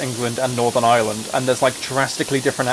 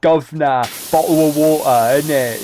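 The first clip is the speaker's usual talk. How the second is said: louder, slow hammed-up Cockney; mock Cockney accent; 2 semitones higher, 5 dB louder, slower than usual. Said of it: "half the time they're like".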